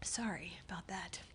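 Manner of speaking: whispered apology after sneezing; breathless whisper after a sneezing fit. Real, not acted. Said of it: "[name] is having a sneezing fit right now".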